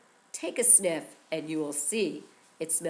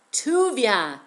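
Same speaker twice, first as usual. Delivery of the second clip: proud naming; 3 semitones higher, 7 dB louder than usual. Acted. Parents naming their son.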